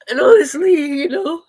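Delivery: loud, high tearful words; real, high-pitched crying voice. Real, not acted. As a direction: push words out through sobs. Still crying after breaking down.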